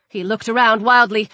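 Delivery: plain reading voice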